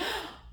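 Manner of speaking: sharp high gasp of alarm; high mock gasp. Nurse gasps in alarm at the leak: high mock gasp. Nurse reacts to water breaking.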